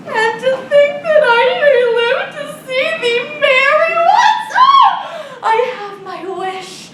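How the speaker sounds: high, loud, crying out with joy; tearful joy. Acted. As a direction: weep for happiness at the thought. Nurse overjoyed, weeping at the thought.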